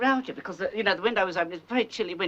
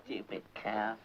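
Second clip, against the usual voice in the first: muttered aside; 11 semitones lower, 13 dB quieter than usual. Acted. Mother insults Iris aside.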